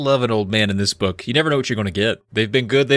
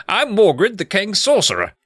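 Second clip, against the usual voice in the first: high, hammed-up British sorcerer; grand introduction in a bad British accent; botched British accent; 10 semitones higher than usual. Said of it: "let's try to do British again".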